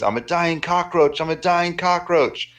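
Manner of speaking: high, slow drawn-out scream. Retold or imitated. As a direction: scream it at the top of your lungs. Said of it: "you scream on top of your lungs".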